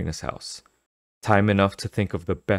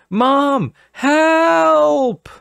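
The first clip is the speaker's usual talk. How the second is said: screaming for help; 8 dB louder than usual. Acted.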